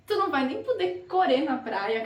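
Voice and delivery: ordinary talk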